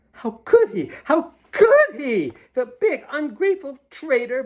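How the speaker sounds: high, loud furious ranting; fuming. A narrator's reading. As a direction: spit out the betrayal in fury. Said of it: "Bombolona fumed".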